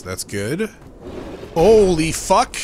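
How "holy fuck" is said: loud, high, drawn-out shock; startled shout. Real, not acted. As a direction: blurt it out in fright. Sudden scare in game.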